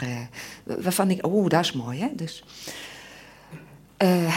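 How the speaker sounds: ordinary talk, nothing marked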